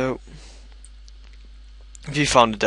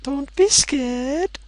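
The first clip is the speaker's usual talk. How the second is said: high, drawn-out little voice; silly high-pitched reassurance; teasing coaxing; much slower than usual. Pretend. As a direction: coax in a slow squeaky voice. Teasing coaxing before going first.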